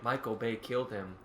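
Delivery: quiet mocking whisper-like tone; hushed gossip. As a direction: murmur it like gossip behind his back. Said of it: "just sitting there like".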